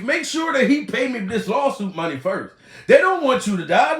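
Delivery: usual speaking voice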